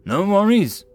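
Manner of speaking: cheerful confident reassurance; grinning. A narrator's reading. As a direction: toss it off with a grin. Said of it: "Rathia grinned".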